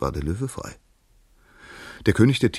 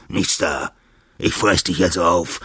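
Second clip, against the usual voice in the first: gruff threat; 6 dB louder than usual. A narrator's reading. Angry lion threatens the mouse.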